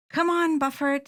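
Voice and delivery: high urgent call. A narrator's reading. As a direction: hurry the turkey along. Fred calling his turkey.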